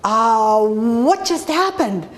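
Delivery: slow, stunned and bewildered; mildly dazed confusion; deer in headlights. Retold or imitated. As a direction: caught totally off guard, slowly. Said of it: "like a deer in headlights going".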